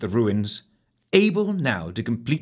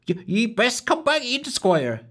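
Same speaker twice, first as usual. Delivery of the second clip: worried; 13 semitones higher than usual. A narrator's reading. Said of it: "worry in her tone".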